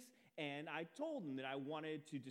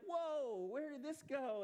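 high startled whoa; a startled, high-pitched retold reaction; taken aback; 12 semitones higher, 4 dB louder, slower than usual. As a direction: jump up in pitch, caught off guard. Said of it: "I was taken aback".

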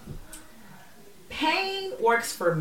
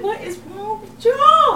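exasperated; 11 semitones higher, 6 dB louder, much slower than usual. Retold or imitated. Retold exasperated cry.

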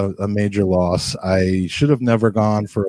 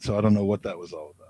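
ordinary delivery

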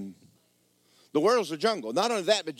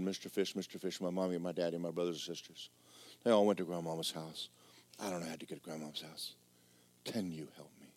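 small creature pleading, much softer and fading; little creature; 8 semitones lower, 11 dB quieter than usual. Acted. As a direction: plead almost under the breath, sinking away.

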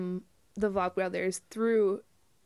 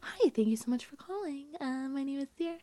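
customer service voice; 5 semitones higher, 5 dB quieter than usual. Retold or imitated. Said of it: "I'm always like".

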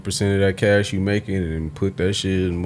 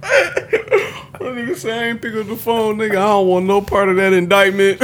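laughing; 12 semitones higher than usual. Retold or imitated. Mocking a friend while laughing.

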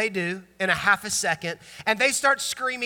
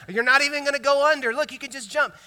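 put-on higher, quick coaxing of a scared child; lightly acted parent urging a kid to jump; 7 semitones higher, 4 dB louder than usual.

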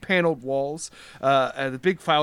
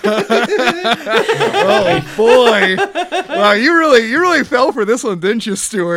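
loud and higher, laughing through the words; laughing gloat; 8 semitones higher, 9 dB louder, slower than usual. Real, not acted. Game master teasing Stuart for taking the bait, laughing. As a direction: crow with delight through real laughter.

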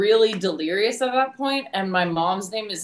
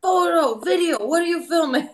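angry vendor; 9 semitones higher than usual. Retold or imitated. Vendor yelling at the filming tourist, high mock French. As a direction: imitate the angry vendor demanding answers.

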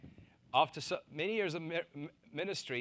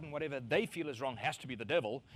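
ordinary talk

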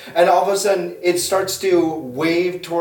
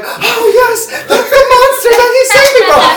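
much higher and louder, laughing through it; gushing Gertrude; 10 dB louder than usual. Acted. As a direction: squeal it out, delighted and overacted. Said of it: "And then Gertrude's like".

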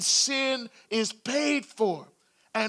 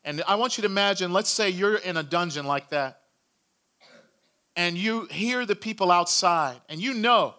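ordinary talk

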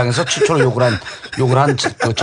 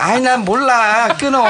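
whiny impression; 9 semitones higher, slower than usual. Mimicking Joo Jin-woo.

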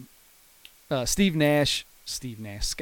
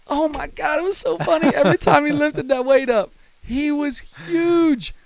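very high-pitched, helpless amusement; cracking up; 12 semitones higher than usual. Real, not acted. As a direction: tell it through real laughter, voice way up high. Cracking up at own funny memory.

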